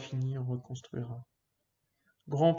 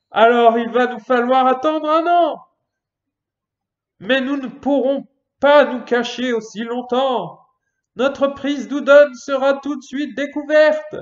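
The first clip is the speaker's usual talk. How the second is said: much higher, loud desperate yell; shouting; 11 semitones higher, 14 dB louder than usual. Acted. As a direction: shout to stop them. Character shouting in alarm.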